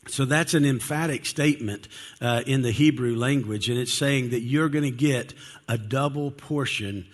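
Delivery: ordinary delivery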